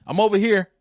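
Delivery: put-on high, loud child's call; boy answering his parents from the aisle. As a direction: call out so your parents find you. What he should have called.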